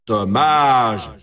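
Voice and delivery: low, drawn-out commiseration; game-over let-down; disappointed announcer. Acted. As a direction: sink into the word with regret. Announcer reacts to player's loss.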